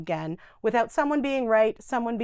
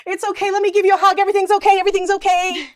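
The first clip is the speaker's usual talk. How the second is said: much louder, higher, fast gushing comfort; overbearing reassurance; 10 semitones higher, 9 dB louder, faster than usual. Shown as a demonstration. Caregiver gushing at an upset resident, loud and rushed. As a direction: smother them with loud cheerful comfort. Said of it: "What do we normally do".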